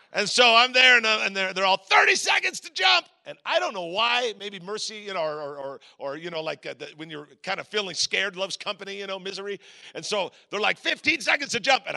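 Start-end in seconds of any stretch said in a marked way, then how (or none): 1.8-3.1 high, slow, loud countdown yell; drawn-out acted shout; imitated crew shout. Crew yelling countdown in open plane. 10.8-11.8 high, loud countdown call; raised retold crew yell; imitated crew call.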